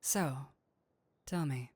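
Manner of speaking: low, sultry demon purr; a seductive demon, low and close; seductive incubus. Acted.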